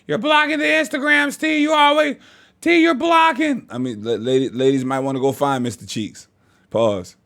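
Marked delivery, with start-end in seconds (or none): 0.1-3.7 high whiny mock of complaining viewers. Vlogger mimicking fans who say he blocks the screen, high whine. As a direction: squeal it like a nagging fan. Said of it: "I don't want y'all saying".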